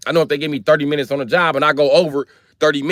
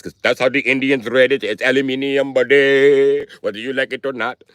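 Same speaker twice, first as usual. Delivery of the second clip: mock Indian accent; caricatured Indian accent, insisting. Indians telling Americans how they read it, mock Indian accent. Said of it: "Are you mad at the Indians?".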